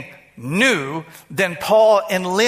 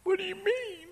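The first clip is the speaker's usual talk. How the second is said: higher, much softer puzzled voice; imitating the lady, puzzled; 12 semitones higher, 10 dB quieter than usual. Pastor voicing the lady's confusion, higher and much softer. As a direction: ask it high and hushed, baffled.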